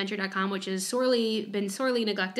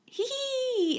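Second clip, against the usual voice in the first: squeaky leprechaun hee hee; high hee hee; 4 dB quieter than usual. Acted. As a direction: tack on a high little hee hee. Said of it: "the Irish exclamation point".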